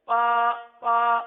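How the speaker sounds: پا loud and drawn out with a voiceless p, "paa"; mispronounced ba as a long "paa"; ba misread as pa. Shown as a demonstration. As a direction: hold پا long on a level note. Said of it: "با کو پا پڑھ دیا جاتا ہے".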